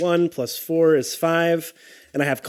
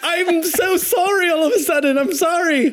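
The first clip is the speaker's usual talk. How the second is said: overblown apology; 14 semitones higher than usual. Acted. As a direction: gush out a fake sorry.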